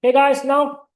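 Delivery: usual speaking voice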